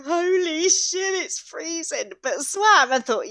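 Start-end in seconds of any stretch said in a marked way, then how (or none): 0.0-2.1 high, drawn-out shocked exclamation; mock cold shock. Mum surfacing from the freezing sea, shocked. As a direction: exclaim as if plunged into icy water.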